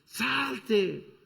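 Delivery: high, urgent cry; desperate shout. Acted. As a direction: yell it again in despair. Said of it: "el otro que está gritando".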